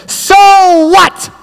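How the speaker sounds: high, loud challenging shout; loud provocative shout at the crowd; shouted so what. Retold or imitated. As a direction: shout it as loud as you can. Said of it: "I shouted as loud as I could".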